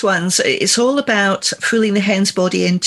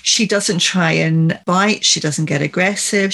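ordinary delivery

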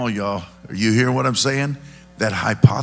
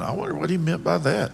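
higher-pitched puzzled wondering; 7 semitones higher than usual. Acted. Listener walking away from Jesus's story, puzzled. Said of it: "you walk away going".

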